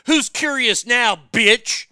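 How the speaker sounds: loud, slow taunting yell; mock monkey yell. Monkey gloats over its victim, yelling loudly. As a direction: bellow it as a gloating killer.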